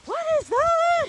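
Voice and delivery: high, loud, slow alarmed squeak; a frightened sheep staring at a stranger; startled sheep voice. Retold or imitated. Voicing the startled sheep Sid.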